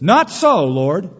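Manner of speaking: loud high protest on Not so; Peter's refusal. Retold or imitated. Peter refusing God in the vision, loud high protest. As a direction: refuse the Lord sharply. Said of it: "Peter ... says".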